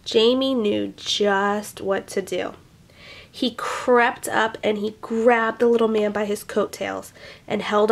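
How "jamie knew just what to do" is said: plain reading voice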